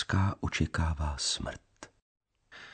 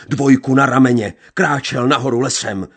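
much higher and louder outburst; furious shout; 8 semitones higher, 10 dB louder than usual. A narrator's reading. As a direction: let the rage break out.

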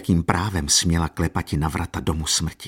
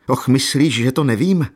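higher, louder pained challenge; agitated reproach; 6 semitones higher, 5 dB louder than usual. A narrator's reading. Jan Maria reproaching Katerina as he feels death near, agitated. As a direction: throw the question out as an accusation. Sick man's jealous reproach.

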